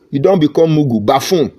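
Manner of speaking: normal talking voice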